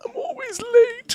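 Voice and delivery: very high squeaky mock voice; mocking whine.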